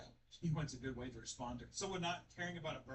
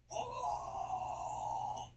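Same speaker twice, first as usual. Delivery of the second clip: mock gasp; 5 dB louder than usual. Mock scream of surprise.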